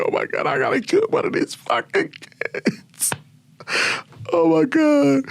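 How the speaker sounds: crying in despair. Retold or imitated. Soldier in the convoy realising she may kill a kid, tearful. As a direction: voice the horror of having to shoot a child. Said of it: "And I'm crying and shit".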